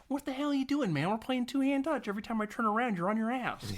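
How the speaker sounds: high, strained mock outrage; exasperated complaint. Player complaining to his rough opponent, high-pitched exasperation. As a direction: protest in a high put-on voice. Acted angry complaint to tackler.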